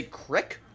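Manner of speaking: "crick" for creek, with a short "i"; dialect pronunciation. Shown as a demonstration. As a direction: say "crick" to rhyme with brick. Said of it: "somebody would say crick".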